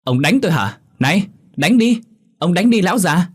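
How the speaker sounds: high, loud goading; acted confrontational shout; defiant dare. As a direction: dare him to strike, pitch way up. Daughter dares father to hit her.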